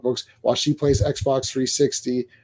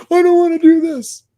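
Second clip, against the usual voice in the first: put-on whiny kid; 11 semitones higher, 4 dB louder than usual. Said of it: "Here it's like".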